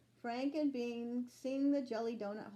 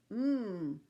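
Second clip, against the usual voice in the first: ordinary delivery, nothing marked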